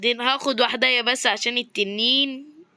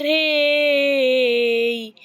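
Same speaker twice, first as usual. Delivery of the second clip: drawn-out yelp; 4 dB louder, much slower than usual. Real, not acted. Gamer yelps while falling in the game. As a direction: stretch the hey into a cry. Hoping for a bed.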